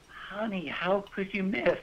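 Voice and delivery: imitated wife, high and soft; innocent wife. Wife to husband who missed his shot, innocently puzzled. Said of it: "my wife so innocently".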